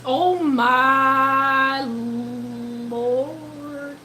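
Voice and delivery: very long drawn-out, stunned words; floored, dragging the words out slowly. Real, not acted. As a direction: stretch every word out in real shock. Stunned by a super chat.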